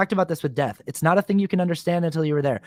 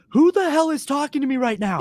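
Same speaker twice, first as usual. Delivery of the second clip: high, bewildered cry at an unseen voice; panicked confusion; 10 semitones higher than usual. Retold or imitated. Man alone on a rooftop hears voices, high panicked confusion. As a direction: ask it high, as if losing your mind. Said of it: "I was literally going".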